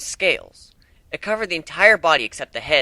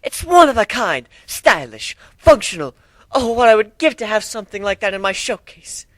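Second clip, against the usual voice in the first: gushing fashionista; 8 semitones higher, 4 dB louder than usual. Acted. Rarity gushing over armor.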